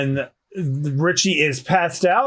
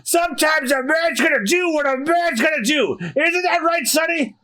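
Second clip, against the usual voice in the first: put-on high-pitched, defiant old man shouting; fired-up mayor; 10 semitones higher than usual. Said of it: "Mayor Boodle is fired up".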